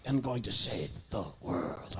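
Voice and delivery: low, slow, mock-grand puppet vow; deep, deliberate dummy declaration; solemn puppet. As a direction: drag it out like a puppet making a vow. Puppet repeats line solemnly.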